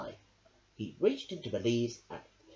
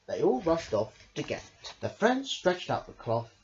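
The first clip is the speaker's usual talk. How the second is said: ordinary delivery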